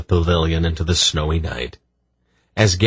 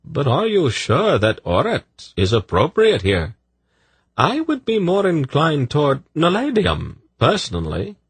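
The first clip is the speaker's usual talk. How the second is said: higher silky male voice; smooth eunuch voice; 10 semitones higher than usual. A narrator's reading. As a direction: purr it politely. Sadi voiced.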